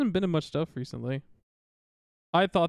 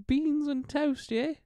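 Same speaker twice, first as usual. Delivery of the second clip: slow, higher British drawl; hammed-up British accent; 9 semitones higher, slower than usual. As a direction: savour it like a Brit. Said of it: "shitty Australian accent".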